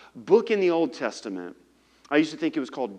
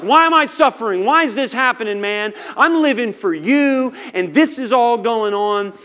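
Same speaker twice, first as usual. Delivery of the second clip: higher, louder pleading complaint; anguished Job; 7 semitones higher, 6 dB louder than usual. Acted. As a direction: cry out at God in frustration.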